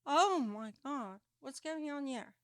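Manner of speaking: normal talking voice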